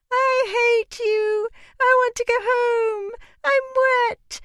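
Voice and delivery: put-on very high, loud shrieking little girl; wailing child. A narrator's reading. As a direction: shriek like a furious soaked child. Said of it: "said Madame above the shrieking".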